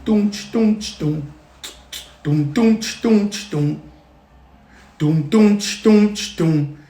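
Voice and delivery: beatbox the congas, doom and ch; vocal drum beat with ch and doom; conga drum imitation. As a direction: tap out a drum rhythm with the mouth.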